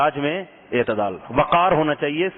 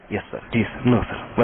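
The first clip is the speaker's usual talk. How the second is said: low, softened obedient replies; hushed, deferential yes-man imitation; meek subordinate; 4 semitones lower, slower than usual. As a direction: play a junior answering a shouting officer. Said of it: "لیکن ماتحت".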